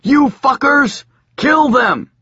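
high, loud murderous yell; furious mob yell. Acted. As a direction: scream for blood. Mob attacking.

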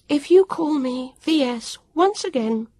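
put-on a cross girl laying down the law; rising irritation, a warning in it; Vivian, cross and warning. A narrator's reading. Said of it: "she said".

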